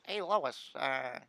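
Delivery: half-hearted cartoon dad impression; Peter Griffin. Streamer trying a requested impression for chat, hesitant cartoon-dad voice. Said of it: "could you do a Peter Griffin impression?".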